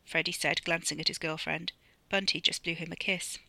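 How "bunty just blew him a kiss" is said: ordinary delivery, nothing marked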